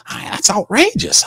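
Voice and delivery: indignant high outburst. Retold or imitated. Mock argument, Mickey protesting.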